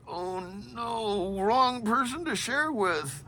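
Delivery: high, soft inner wail; drawn-out squeak of comic regret; mock dismay. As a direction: think it aloud in a high, small voice. Inner dismay at confiding wrongly.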